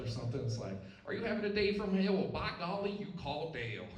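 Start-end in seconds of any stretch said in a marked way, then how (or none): 1.0-3.9 mock Southern accent. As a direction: play a country lawyer selling hard.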